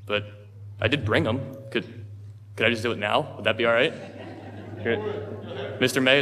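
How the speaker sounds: ordinary talk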